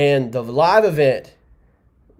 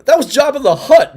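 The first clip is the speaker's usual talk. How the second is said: much higher and louder, amused; laughing exclaim; 13 semitones higher, 8 dB louder than usual. Real, not acted. Host joking the caller sounded like Jabba, laughing loudly. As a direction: blurt it out mid-laugh. Laughing at a garbled phone call.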